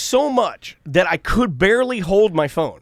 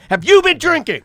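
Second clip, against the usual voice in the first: much higher, louder repeated demand; retold yelling; 12 semitones higher, 6 dB louder than usual. Repeating the question to the driver, retold angry yell. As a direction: re-enact yelling the question, escalating. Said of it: "And I'm yelling".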